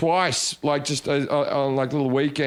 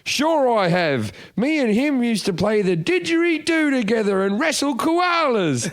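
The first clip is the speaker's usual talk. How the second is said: high, slow, exaggerated Australian accent; Russell Peters' Australian impersonation; 9 semitones higher, slower than usual. Comic retells a stranger mocking him, exaggerated Australian accent. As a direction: drag out a mock Aussie accent, pitched up. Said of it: "went on to do an Australian impersonation".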